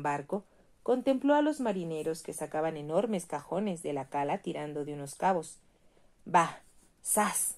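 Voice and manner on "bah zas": a short heave-ho shout, louder; mild mock yell of working men; sailors shouting. A narrator's reading. Sailors haul crates and shout with each one, mildly loud.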